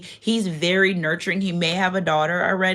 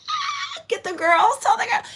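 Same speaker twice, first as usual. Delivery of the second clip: excited squeal. Real, not acted. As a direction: leap up in pitch, thrilled and urgent. Excited about ships arriving.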